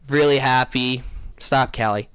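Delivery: ordinary delivery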